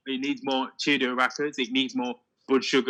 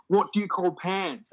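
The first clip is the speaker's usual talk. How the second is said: higher and slow, drawn-out curious American question; slow mock American; 6 semitones higher, slower than usual. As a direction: ask it like an amazed American kid. American friends quizzing British words.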